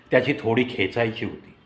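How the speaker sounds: ordinary delivery, nothing marked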